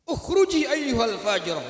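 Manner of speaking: loud, slow furious command; angry expulsion. Acted. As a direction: drive her out in anger. Angry Musa expels woman.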